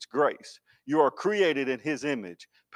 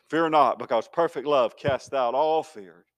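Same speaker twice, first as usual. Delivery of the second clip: usual speaking voice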